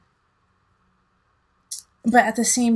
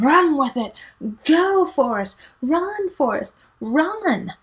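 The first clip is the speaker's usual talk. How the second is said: high cheering movie quote, much higher; Run Forrest cheer; 6 semitones higher, 3 dB louder, slower than usual. Retold or imitated. Cheering the sign on like Forrest Gump, high. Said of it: "Forrest Gump quote".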